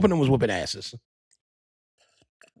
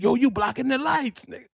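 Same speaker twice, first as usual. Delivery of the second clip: higher, bossy director voice; 10 semitones higher than usual. Retold or imitated.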